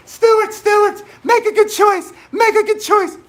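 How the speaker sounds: squeaky high pleading teacher; mock the helpless teacher, high and loud; shrill-free high pleading cry of a flustered kindergarten teacher; high-pitched teacher voice. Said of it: "in a high-pitched voice".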